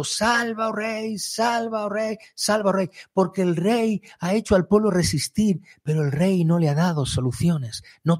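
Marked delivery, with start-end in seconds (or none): 0.0-2.9 high, pleading cry to the king; crowd's plea. Retold or imitated. People of Samaria begging their king, high pleading cry. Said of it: "gente protestando".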